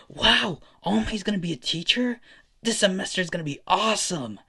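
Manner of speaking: high-pitched, excited cheer; thrilled students. Acted. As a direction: gush like an amazed classroom. Class thrilled by teacher news.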